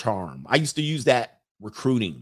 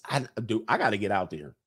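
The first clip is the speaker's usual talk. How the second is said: ordinary talk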